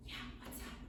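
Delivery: whispered reply. Acted. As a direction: whisper back secretly. King whispers back.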